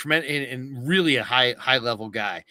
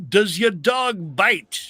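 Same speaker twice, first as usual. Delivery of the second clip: retold movie line; 6 semitones higher, 4 dB louder, slower than usual. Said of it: "the guy goes".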